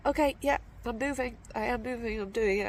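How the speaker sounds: tearful giving in. Retold or imitated. Crying hiker telling the man she is leaving, loud and tearful. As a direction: blurt it out through tears to get away. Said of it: "I was just like".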